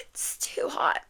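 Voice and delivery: high, breaking-up giggly words; real giggle. Real, not acted. Reader cracks up over love too hot, giggling. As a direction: squeak it out softly through laughter.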